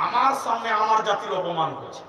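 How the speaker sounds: ordinary delivery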